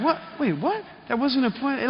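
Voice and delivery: high, stunned double take; stunned disbelief. Retold or imitated. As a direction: catch yourself in shock. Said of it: "I'm like".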